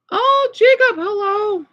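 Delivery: sudden high bright hello; light up as a friend shows up; excited greeting. Real, not acted. Streamer greeting a viewer named in chat, high and excited.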